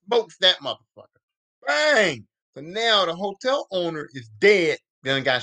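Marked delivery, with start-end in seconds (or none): 1.7-2.3 tossed-off echo of the gunshot; lighter gunshot bang. Retold or imitated. Gunshot repeated.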